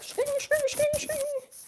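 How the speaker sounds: high-pitched repeated 'shing' effects; anime sword sounds. Retold or imitated. Contrasting Japanese anime with Disney, high sharp sound effects. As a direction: imitate anime blades in a high squeal. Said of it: "Japanese anime, like".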